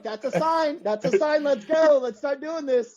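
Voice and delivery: very high-pitched retold elation; excited rallying cry. Friend retelling his decision to start, excited. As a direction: act out seizing on a sign, voice way up.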